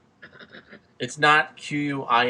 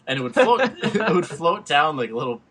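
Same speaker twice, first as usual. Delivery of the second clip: laughing; 5 semitones higher, 8 dB louder than usual. Real, not acted. Laughing while telling the prank.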